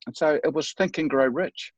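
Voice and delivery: ordinary talk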